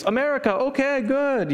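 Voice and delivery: high, put-on foreign voice, sing-song; broken English. Locals' only English, accented.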